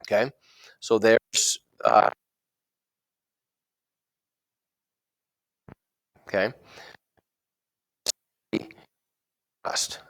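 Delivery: audio cutting out; words dropping out mid-line; choppy cut off endings. Real, not acted. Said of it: "You're cutting out a lot".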